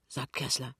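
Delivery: ordinary delivery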